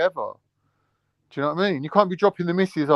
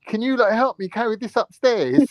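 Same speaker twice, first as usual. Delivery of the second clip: sweet mum favour; 7 semitones higher than usual. Retold or imitated.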